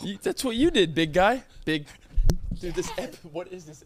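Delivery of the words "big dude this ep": ordinary delivery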